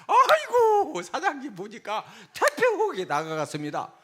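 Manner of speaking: high, loud gushing exclamation; hammed-up flattery with a laugh; fawning hostess. Hostess flattering a boastful customer, exaggerated gushing. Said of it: "막 동의를 해줘".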